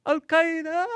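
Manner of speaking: very high sing-out of Al Qaeda, last vowel held; playful pronunciation. Pretend. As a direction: squeal Al Qaeda, stretching the final AH. Playing with pronunciations of it.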